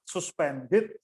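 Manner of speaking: slow SUSPENDED with first syllable as "sus"; SUS pronunciation. Shown as a demonstration. Choir coach contrasting two pronunciations, SUS version. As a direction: say SUSPENDED slowly, opening on an oo sound. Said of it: "sus dan sas itu beda".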